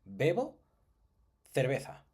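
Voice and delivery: slow, spelled-out example phrase; careful teacher demo. Shown as a demonstration. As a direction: say "yo bebo cerveza" slowly, word by word. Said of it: "Por ejemplo".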